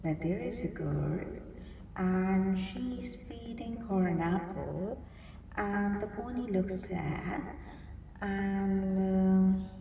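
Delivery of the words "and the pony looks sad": ordinary delivery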